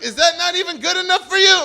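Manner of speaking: high, loud indignant shout; indignant yell. Retold or imitated. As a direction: yell it in outrage. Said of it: "and I screamed at her".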